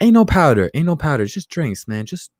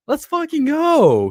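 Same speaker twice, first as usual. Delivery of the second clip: pitched way up, drawn-out victory yell; higher hype shout; 14 semitones higher, slower than usual. Real, not acted. Streamer celebrates Watson staying, high drawn-out yell. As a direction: let it soar even higher. Hypes Texans keeping Deshaun Watson.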